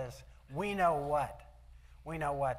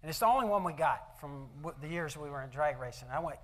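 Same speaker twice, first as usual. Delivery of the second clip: normal talking voice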